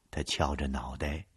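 plain reading voice

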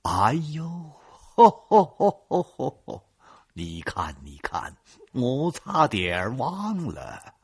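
booming put-on laughter; hearty fake laugh. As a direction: laugh it off like a big shot. Boss laughs, pretends to remember. (装模作样地哈哈大笑)

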